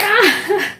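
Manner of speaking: high acted-out burst of laughter; laughing cry while retelling. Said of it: "I was laughing".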